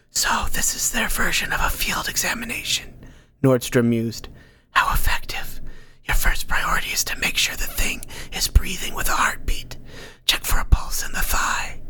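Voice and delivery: breathy unvoiced whisper, close and secret. Unseen Nordstrom whispering advice to Ank. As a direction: murmur it like a hidden advisor in his head.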